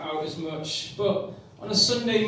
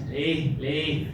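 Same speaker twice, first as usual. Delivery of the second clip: put-on Spanish accent; 3 semitones lower than usual. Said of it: "he's like this".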